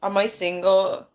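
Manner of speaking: usual speaking voice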